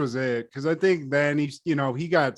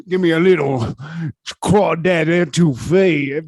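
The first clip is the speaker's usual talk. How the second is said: mock Louisiana accent; 5 dB louder than usual. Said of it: "a Louisiana accent".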